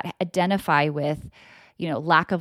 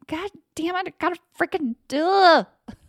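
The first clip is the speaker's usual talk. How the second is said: grudging complaint; 11 semitones higher, 4 dB louder than usual. Retold or imitated. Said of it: "I'm like".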